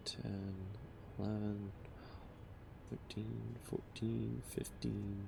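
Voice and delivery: very soft, absorbed counting; barely audible tally, spaced out; counting to self. Real, not acted. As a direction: count under your breath as you work. Counting blocks to himself while building.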